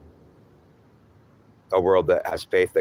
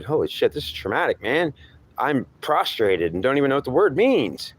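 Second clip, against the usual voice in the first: higher, heated arguing with himself, rising to a shout; agitated inner voice; 7 semitones higher than usual. Acted. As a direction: rant at God in mounting frustration. Inner argument voiced in higher pitch.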